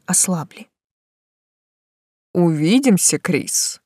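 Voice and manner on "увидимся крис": tender. A narrator's reading. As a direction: say the name lovingly. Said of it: "с особой нежностью и удовольствием".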